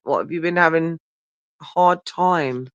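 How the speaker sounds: ordinary delivery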